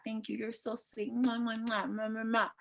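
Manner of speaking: soft sweet murmur trailing into kisses; cooing thanks. Acted. Woman thanking her man for lunch, cooing. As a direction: coo it softly with little mwahs.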